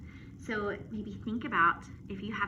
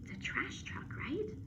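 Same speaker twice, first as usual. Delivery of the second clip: whispered aside; 6 semitones higher, 9 dB quieter than usual. Real, not acted. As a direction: whisper like sharing a secret. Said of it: "whispered aside to kids".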